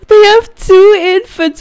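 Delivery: high, loud gasping squeal; excited gasp. Real, not acted. Player spotting a second baby, loud excited squeal. Gasps at new tenants' babies.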